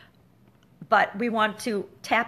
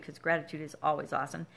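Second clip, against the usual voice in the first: ordinary talk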